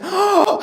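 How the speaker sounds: high shocked gasp; sharp gasp. Retold or imitated. Customer reading a shocking chapter, gasping. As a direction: gasp in disbelief.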